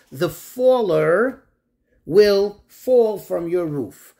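"the faller, will fall" spelled out very slowly; deliberate slow emphasis on "fall"; slow stressed quote. Shown as a demonstration. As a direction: lean on "faller" and "fall" one by one. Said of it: "Why does the Torah refer to him as one who falls".

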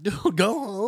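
put-on fed-up plea to a stubborn drunk; exasperated dude. Bartender to drunk climbing the fence, exasperated and high.

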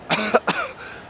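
breathless coughs; weak coughing. Acted. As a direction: cough weakly. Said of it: "I coughed".

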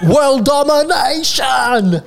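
high, drawn-out wide-mouthed British wail; hammed-up British rock singer crossed with a cartoon mouse. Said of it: "[name] is basically Pinky".

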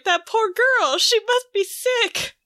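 lightly hammed-up concern; mock pity.

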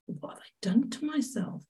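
breathless whispered panic; frightened whisper. Retold or imitated. Asking herself in fear, a hushed whisper.

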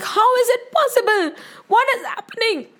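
high-pitched stunned disbelief; shocked. Acted. Rana sees Meera survive the poison, shocked. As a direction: gasp out questions in high panic. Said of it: "He was so shocked".

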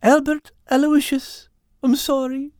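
high, apologetic Irish tomcat. Acted. Al introducing himself to the mystifying cat, high sheepish voice. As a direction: give the name meekly in a high Irish voice. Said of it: "Al, catching his breath".

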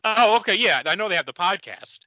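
ordinary delivery

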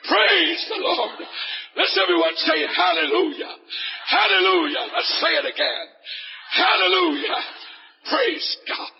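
rally the congregation into shouted praise; full-force preaching. Real, not acted. Preacher urging the audience to praise the Lord together, full-force preaching.

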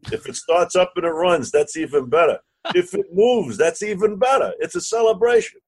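high-pitched, rising salesman's enthusiasm; excited hype. Retold or imitated. Partner talking up the submarine deal, excited.

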